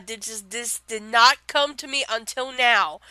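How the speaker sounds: ordinary talk, nothing marked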